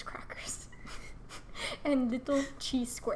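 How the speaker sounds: whispered, slow confession; admitting a tiny splurge in a whisper; whispered aside. Retold or imitated. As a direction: whisper it like a guilty secret.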